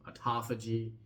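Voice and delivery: autophagy as uh-TAH-fuh-jee; alternative pronunciation. Shown as a demonstration. Presenter tests another way to pronounce this word, autophagy as uh-TAH-fuh-jee. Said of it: "understanding how to even pronounce this word".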